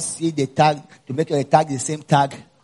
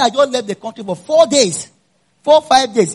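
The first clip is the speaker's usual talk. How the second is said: louder, higher, heated retelling; indignant traveller protesting; retold anger; 9 semitones higher, 7 dB louder than usual. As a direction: replay the irritation at the officer. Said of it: "dude, I was so angry".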